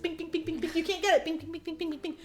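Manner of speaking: high, light mock ricochet noises; ping each syllable out high and teasing; cocky elf taunt. Voices elf deflecting arrows with pan.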